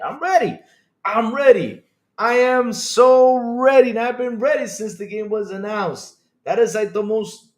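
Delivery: high, loud excited insistence; hyped. Real, not acted. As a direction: burst out with real hype, stretching the words. Hyped for the new Doom.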